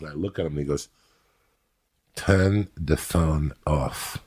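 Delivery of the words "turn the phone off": put-on slow, drawn-out German accent; stretched-out German-accented command. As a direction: imitate a German ordering slowly, word by word. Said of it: "A German guy".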